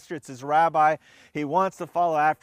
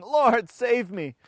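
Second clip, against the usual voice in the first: cry for help; 6 semitones higher, much slower than usual. A narrator's reading. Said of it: "He cried out".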